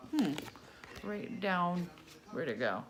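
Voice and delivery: ordinary delivery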